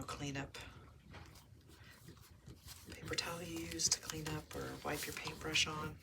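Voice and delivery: usual speaking voice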